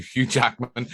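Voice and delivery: ordinary talk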